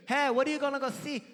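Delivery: high-pitched mock mom voice; imitating Korean mom. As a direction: pitch it up as a caricatured Korean mother. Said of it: "she didn't say it like that".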